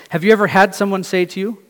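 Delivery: ordinary delivery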